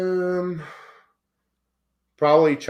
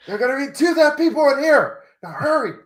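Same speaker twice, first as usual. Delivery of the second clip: acted movie line; 10 semitones higher, 3 dB louder, faster than usual.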